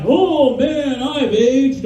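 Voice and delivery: high, loud, slow creaky skeleton lament; skeleton miner. Retold or imitated. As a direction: drag it out like an old bony ghost. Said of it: "And he's like".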